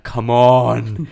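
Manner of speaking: loud, exasperated retold plea; frustrated outburst. Player stuck at walking speed in camp, frustrated. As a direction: groan it out loudly at the game. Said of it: "I get so frustrated with it".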